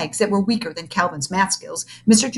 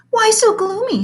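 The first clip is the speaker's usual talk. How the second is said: 1 cheerful librarian; 6 dB louder than usual. A narrator's reading. Cheerful teacher enters.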